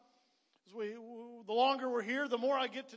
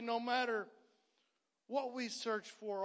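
ordinary talk

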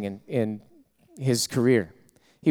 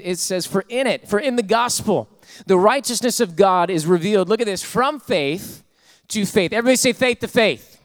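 high, loud fervent preaching, rising; full-force preaching; 8 semitones higher, 5 dB louder than usual. Acted. Pastor preaching fervently to his congregation, loud and high. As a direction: preach it hard to the church.

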